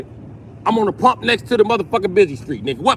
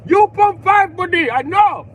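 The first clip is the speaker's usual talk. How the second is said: put-on much higher, louder Indian accent, certain; 10 semitones higher, 5 dB louder, slower than usual. Indian cashier insisting on pump five, Indian accent. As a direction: correct him like a knowing cashier. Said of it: "He be like".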